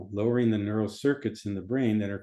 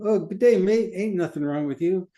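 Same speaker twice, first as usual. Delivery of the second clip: put-on high, loud, playful Aussie accent; Australian accent; 9 semitones higher, 5 dB louder than usual. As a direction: ham it up as an Australian. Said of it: "Say it in Australian".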